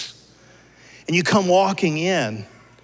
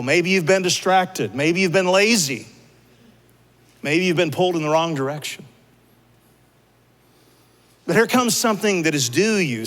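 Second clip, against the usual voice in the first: ordinary delivery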